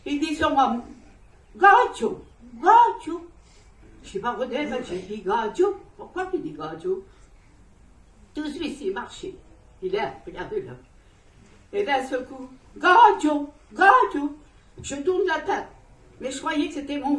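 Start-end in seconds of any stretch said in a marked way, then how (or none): 1.6-3.3 loud, high call across the woods. Retold or imitated. Someone calling out to her. 12.8-14.4 loud, high call across the woods. Retold or imitated.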